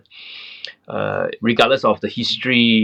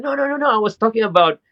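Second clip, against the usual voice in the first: higher, fast flustered denial; frantic rushed excuse; panicked; 7 semitones higher than usual. Retold or imitated. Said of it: "he really freaked out".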